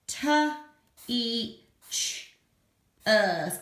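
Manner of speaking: T, E, ch, er said one by one; segmenting teacher. Shown as a demonstration. Teacher segments teacher for children. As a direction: sound out each digraph of teacher. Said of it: "segmenting teacher".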